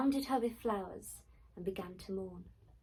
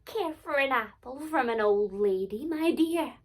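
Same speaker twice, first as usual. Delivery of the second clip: put-on old crone; 3 semitones higher, 9 dB louder than usual. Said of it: "disguised herself as an old crone".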